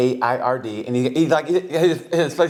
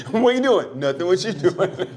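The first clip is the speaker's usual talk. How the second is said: higher, playful buddy banter; mock casual chat; 5 semitones higher, slower than usual. Mock congressman and him chatting like buddies, playful. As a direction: play both friends chatting. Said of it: "It's not like he".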